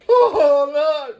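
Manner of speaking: high, loud laughing "oh no"; hammed-up laughing dismay; mock dismay. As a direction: play up the horror through a laugh.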